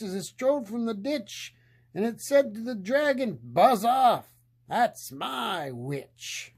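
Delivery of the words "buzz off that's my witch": monster voice. Acted. Beast warning off the dragon, a lightly voiced monster snarl. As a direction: growl it like a many-headed beast. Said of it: "a yowl and a growl and a croak and a shriek".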